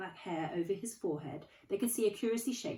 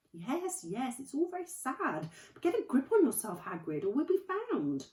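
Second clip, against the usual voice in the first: usual speaking voice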